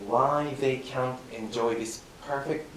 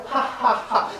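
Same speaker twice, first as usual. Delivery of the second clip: high laughing ha ha ha; sheepish high laugh; laughter; 7 semitones higher, 3 dB louder than usual. Real, not acted. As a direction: burst out laughing. Pupil laughing at himself.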